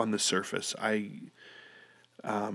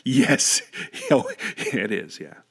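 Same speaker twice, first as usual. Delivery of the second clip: laughing reply; 3 semitones higher, 5 dB louder, much slower than usual. Retold or imitated. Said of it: "And I said".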